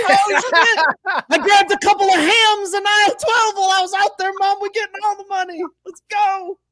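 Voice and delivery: very high, loud excited shout; squealing game-show yell; hyped shopping spree. Acted. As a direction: yell it like a contestant racing the aisles.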